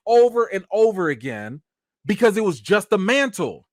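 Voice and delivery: usual speaking voice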